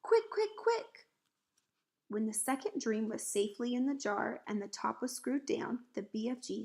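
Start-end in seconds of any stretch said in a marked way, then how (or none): 0.0-1.1 very high, rapid-fire urging; urgent cry. A narrator's reading. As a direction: hurry them along with high yelps. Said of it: "he cried".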